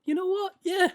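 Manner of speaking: high, bright pleased voice; mock cheerful. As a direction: sound suddenly upbeat. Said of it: "you're just like".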